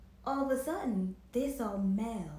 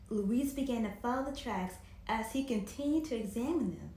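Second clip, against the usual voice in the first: usual speaking voice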